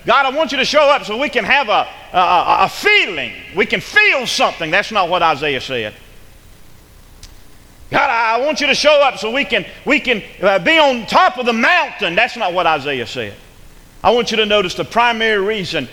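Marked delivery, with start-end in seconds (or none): 0.1-4.7 higher, mock eager plea to God; imitating others' prayer. Preacher imitates folks praying for a feeling, pleading higher. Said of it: "a lot of folks pray this way". 7.9-12.1 higher-pitched mock plea; imitating others' prayer. Preacher mimics folks praying for the mountaintop, pleading higher. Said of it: "a lot of folks pray this way".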